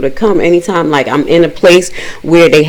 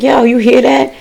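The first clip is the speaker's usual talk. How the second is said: retold startled question; 7 semitones higher than usual.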